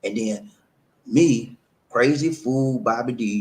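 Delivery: ordinary talk, nothing marked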